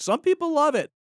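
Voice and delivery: high mock voice. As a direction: pitch it way up mockingly.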